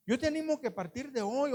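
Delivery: ordinary talk, nothing marked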